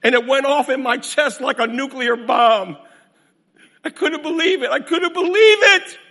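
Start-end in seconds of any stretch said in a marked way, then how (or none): 3.8-6.0 loud disbelief. Acted. Preacher's disbelief.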